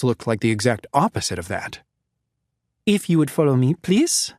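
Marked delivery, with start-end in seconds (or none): 2.9-4.3 lightly voiced refined French accent, a touch higher; polite doctor with a hint of a French lilt; slight French accent. Doctor apologizing to the captives, slight refined French accent. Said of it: "slightly French accent".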